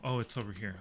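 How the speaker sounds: ordinary talk, nothing marked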